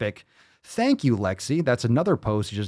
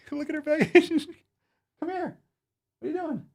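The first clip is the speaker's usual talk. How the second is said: dog talk. Real, not acted. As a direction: coo sweetly at the pup. Talking to the dog.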